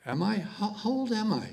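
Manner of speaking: surprised mom. Retold or imitated. Mom voiced asking age.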